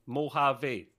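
"Mojave" as moh-HAH-vee, silent J; demonstrating "Mojave" with an H sound where the J is; correct pronunciation. Shown as a demonstration. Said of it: "You don't pronounce the J".

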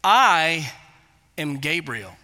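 weighty pause after "I"; stress on I. Retold or imitated. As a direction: angel answering with solemn emphasis. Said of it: "to give the same emphasis".

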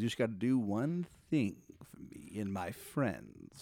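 slow, softened genie bargain. Acted. Genie asking a favor for his friends, slow and soft. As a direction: stretch it out like a shady favor. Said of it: "He goes".

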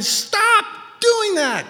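very high, drawn-out shout; full-force exhortation, pitch way up; calling out. Acted. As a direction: shout the order, stretching it long. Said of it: "someone calling you out and saying".

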